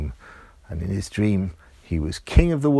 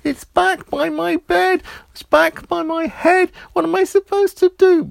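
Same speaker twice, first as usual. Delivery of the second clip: put-on very high, louder frantic child voice; panicking boy; 5 dB louder than usual. A narrator's reading. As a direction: plead in a panic. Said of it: "Roger said".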